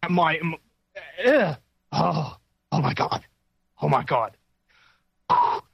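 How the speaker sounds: breathless, winded oh my God; gasping after running around; out of breath. Real, not acted. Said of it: "need to catch my breath here".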